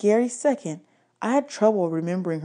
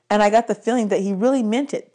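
ordinary delivery

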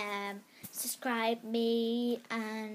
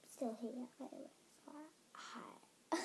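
whispering; 12 dB quieter than usual. Real, not acted. Whispered aside greeting.

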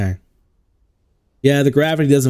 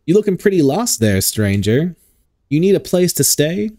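put-on Southern accent; 4 semitones higher than usual. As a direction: play the welcoming Southern barmaid, lightly. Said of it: "Southern accent. Called it".

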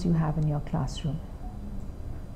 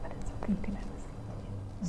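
whisper; 17 dB quieter than usual. Shown as a demonstration. Quiet aside to child.

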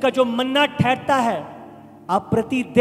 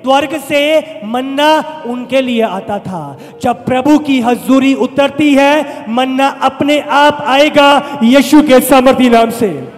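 loud, raised sermon voice; full-force preaching; 2 semitones higher, 7 dB louder than usual. Real, not acted. Preacher assuring the congregation manna will come, full-force preaching. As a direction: build to a loud declaration in Jesus' name.

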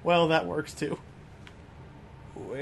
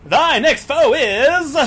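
higher, much louder showman voice; game show host voice; 8 semitones higher, 11 dB louder, slower than usual. Pretend. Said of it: "[name] now has a game show host voice".

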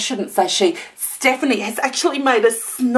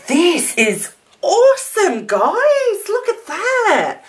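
excited; 10 semitones higher than usual. Real, not acted. Showing album to viewers.

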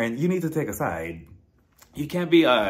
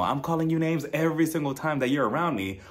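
ordinary delivery

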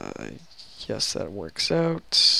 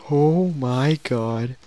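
surprised; 7 dB louder, much slower than usual. Real, not acted. Reacts to a monster in game.

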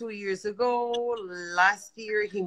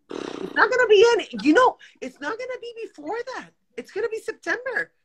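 exasperated outburst; 11 semitones higher, 6 dB louder, faster than usual. Real, not acted. Exasperated mom venting about school.

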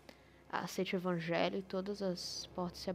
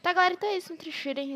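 excited; 10 semitones higher, 11 dB louder, faster than usual. Real, not acted. Outro after scare.

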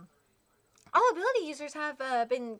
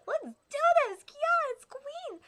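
much higher, slow showman voice; hammed-up showman entrance; flamboyant villain; 12 semitones higher, 5 dB louder, slower than usual. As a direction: ham up a grand entrance.

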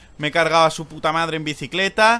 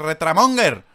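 usual speaking voice

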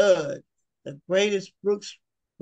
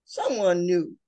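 ordinary talk, nothing marked

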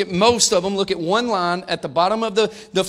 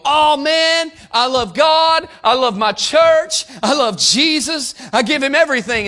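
loud, higher, hammed-up praise voice; mock churchgoer gushing; 7 semitones higher, 6 dB louder, slower than usual. Churchgoer gushing to his congregation in mock praise. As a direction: gush it like a showy believer.

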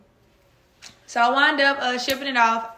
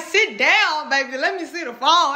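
high, loud imitation of a concerned cousin; raised-voice fussing; urgent soothing; 5 semitones higher, 5 dB louder than usual. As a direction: take charge of someone in mock panic. Cousin takes charge of crying speaker.

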